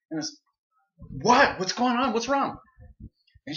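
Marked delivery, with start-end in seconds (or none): 1.2-2.6 high, loud startled questions; alarmed. Retold or imitated. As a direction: jump up in alarm and shout back. Startled reply to wife's call.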